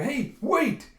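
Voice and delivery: high, loud acted shout; calling out. Guard calling after fleeing Yona, alarmed shout. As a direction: yell after someone running off.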